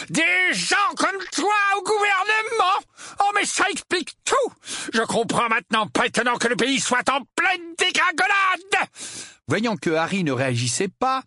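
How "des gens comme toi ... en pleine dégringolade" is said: high, outraged uncle ranting; bellowed rant. A narrator's reading. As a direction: rant at full pitch in disgust. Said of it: "beugla l'oncle Vernon". (tirade beuglée)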